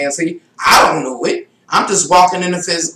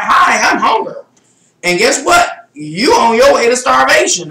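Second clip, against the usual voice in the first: hammed-up Hunger spirit, higher and louder, half shouted; silly spirit voice; 6 semitones higher, 5 dB louder than usual. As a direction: play the spirit of Hunger announcing itself, a bit silly. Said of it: "I'm being silly".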